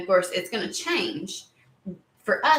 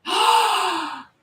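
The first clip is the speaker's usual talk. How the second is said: loud, high dramatic gasp; 10 semitones higher, 4 dB louder than usual. Pretend. Dramatic reaction to seeing Angel.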